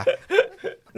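high breathy laugh. Real, not acted. Host laughs at joke.

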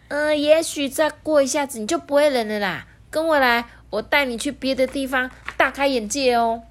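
high, perky reassurance; upbeat penguin. A narrator's reading. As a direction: coax your friend along in a high voice. Penguin character cheers shivering friend.

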